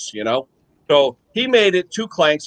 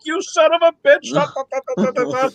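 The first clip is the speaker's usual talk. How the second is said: angry father; 8 semitones higher than usual. Retold or imitated. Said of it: "his father's like".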